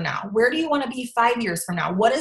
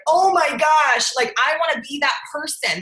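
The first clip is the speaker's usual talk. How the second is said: admiring onlooker; 5 semitones higher than usual. Retold or imitated.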